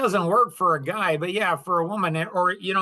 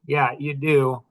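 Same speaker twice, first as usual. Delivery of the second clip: ordinary talk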